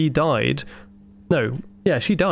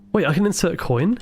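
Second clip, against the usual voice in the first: ordinary talk, nothing marked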